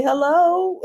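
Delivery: sing-song hello. Retold or imitated. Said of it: "Doctor Mimi say".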